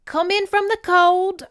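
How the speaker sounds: high, loud British great-aunt welcome; bad British accent. Great aunt greeting family at her door, bad British accent. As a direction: call out warmly in a hammed-up British accent.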